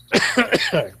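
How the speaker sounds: real sudden cough; clearing a choked throat; coughing fit. Real, not acted. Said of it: "I'm choking up".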